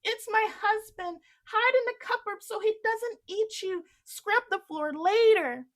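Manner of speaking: urgent warning. Acted. Giantess urging Jack to hide, high and loud. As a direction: hurry him into hiding. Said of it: "said the woman".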